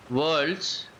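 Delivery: ordinary talk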